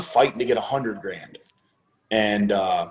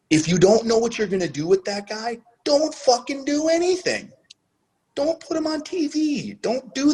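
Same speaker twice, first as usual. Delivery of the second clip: venting, voice climbing higher with each line; fed-up wrestler venting at the company, rising higher; exasperated rant; 14 semitones higher than usual. Real, not acted. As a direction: pitch shooting way up in real frustration. Venting at company's lack of direction.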